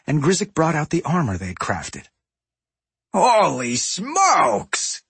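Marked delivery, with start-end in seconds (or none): 3.1-5.0 slow awed raspy gasp; amazed exclamation. A narrator's reading. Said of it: "Gallywix exclaimed".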